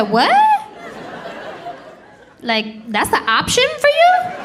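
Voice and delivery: high, disgusted disbelief; disgusted wife. Retold or imitated. Wife refusing husband the toothbrush, high and disgusted. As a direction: recoil at the idea, voice climbing. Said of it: "I said".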